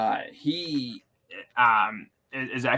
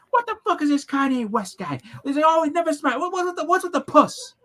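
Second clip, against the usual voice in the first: imitating angry comedian; 13 semitones higher, 4 dB louder, faster than usual. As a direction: rant as the grumpy old comedian. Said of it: "imitate any comedian".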